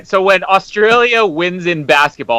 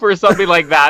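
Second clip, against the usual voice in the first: usual speaking voice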